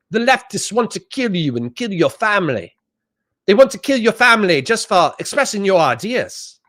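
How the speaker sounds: high-pitched mock Australian voice; Australian accent. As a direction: put on a high Australian accent, imitating an alarmed rant. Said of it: "that's my Australian accent".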